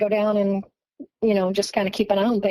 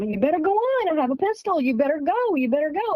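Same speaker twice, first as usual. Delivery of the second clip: mock yell, raised pitch without the volume; retold yelled warning; 6 semitones higher, faster than usual. Boyfriend warning the red-eyed figures off with a pistol, mock yelling. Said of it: "was yelling at 'em".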